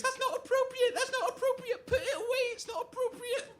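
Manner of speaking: very high, mocking falsetto imitation; uppity Angela. Best man mimicking Angela's objection, uppity and squeaky. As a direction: mimic a prim, uppity scold. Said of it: "You say it like a catchphrase".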